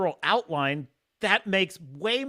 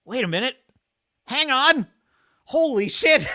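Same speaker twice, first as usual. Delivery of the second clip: higher, louder stunned disbelief; astonished reaction; 7 semitones higher, 7 dB louder than usual. Retold or imitated. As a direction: react like you just cracked the code. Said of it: "I was like".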